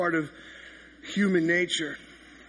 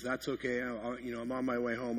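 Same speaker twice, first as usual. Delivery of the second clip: a hint of the wife, much softer; wife on the phone calmly saying she's heading home; 5 semitones lower, 8 dB quieter than usual. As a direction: let her gently reassure him, low key. Wife answers his apology on phone.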